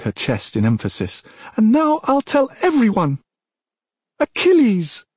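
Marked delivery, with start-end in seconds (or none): 1.6-3.2 very high, loud tirade; enraged princess. Acted. Princess turns on her father, high enraged outburst. 4.2-5.0 high, loud scream of a name. A narrator's reading. Princess screams his name up to the gods, piercingly high. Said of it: "She screamed as if she would force his name".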